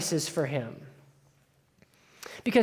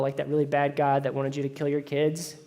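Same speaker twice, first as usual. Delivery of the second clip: normal talking voice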